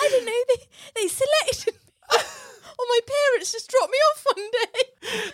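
very high, laughing-crying; laughing elf voice. Retold or imitated. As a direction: answer as the elf, giggling. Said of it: "answers as elf".

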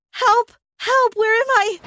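high, loud panicked cries; screaming for help. Acted. Girl waking in a dark hospital room, screaming for help. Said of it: "I began screaming".

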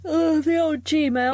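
slow, said through a yawn; yawning while saying it. Real, not acted. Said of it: "Sorry, I'm yawning, mate".

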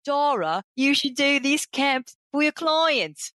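high, slow, hammed-up Australian accent; a friend's Aussie voice, badly faked; attempted Australian accent. Mates urging her to run camps, in a clumsy Australian accent. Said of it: "I'm gonna attempt an Australian accent".

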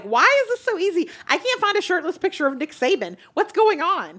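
baffled. Retold or imitated. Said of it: "And I was like".

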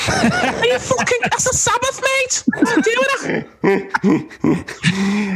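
put-on very high-pitched Liverpool accent with laughter; mock Liverpudlian. Host mocks Liverpool guest about Sabbath, high Scouse protest. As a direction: squeal in exaggerated Scouse outrage. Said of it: "so Liverpool".

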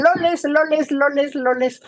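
high mock Chinese street vendor accent; imitating Chinese vendors. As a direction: call out like a market hawker with a Chinese accent. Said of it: "nos decían".

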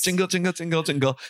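high chirpy jingle imitation; sing-song jingle. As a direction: chirp it like a happy machine tune.

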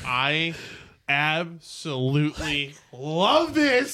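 slow, emphatic delight, stretched out. Real, not acted. Game master to players about their idea, delighted.

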